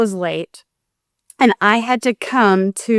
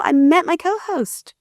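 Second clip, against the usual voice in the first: normal talking voice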